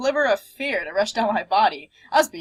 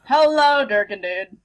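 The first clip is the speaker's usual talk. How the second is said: loud greeting; 5 dB louder, slower than usual. Real, not acted. Greeting the dragon.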